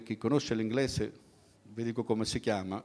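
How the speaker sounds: ordinary talk, nothing marked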